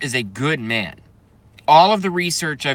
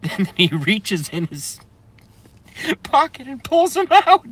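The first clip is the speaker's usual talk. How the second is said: high, breaking into giggles; helpless laughter retelling the turtle bit; laughing fit; 8 semitones higher than usual. Real, not acted. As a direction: laugh through the words. Still laughing.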